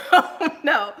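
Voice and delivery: ordinary talk, nothing marked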